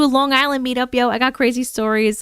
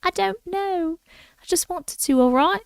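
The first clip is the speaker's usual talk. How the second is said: put-on English accent; 6 semitones higher than usual. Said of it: "to an English accent".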